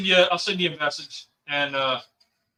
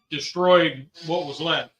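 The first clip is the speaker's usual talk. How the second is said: ordinary delivery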